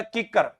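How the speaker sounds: किक्कर starting with a K sound; correct word किक्कर. Shown as a demonstration. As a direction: say किक्कर with k at the front. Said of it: "पर मैं इसको बोलता था टिक्कर".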